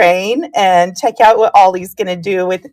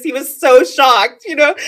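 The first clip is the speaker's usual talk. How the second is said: ordinary delivery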